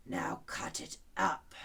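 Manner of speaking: lion's low order. A narrator's reading. Lion telling Alice to cut, low and slow. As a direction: rumble the command.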